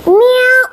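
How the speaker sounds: high imitated meow; cat meow. Cat at the animals' meeting, high meowing.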